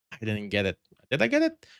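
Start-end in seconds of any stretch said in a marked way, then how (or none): 1.1-1.7 surprised high. Real, not acted. Surprised check.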